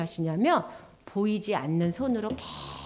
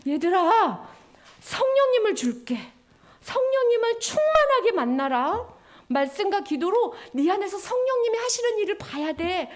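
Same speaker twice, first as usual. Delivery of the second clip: tender promise; 7 semitones higher than usual. Acted. God's loving promise voiced.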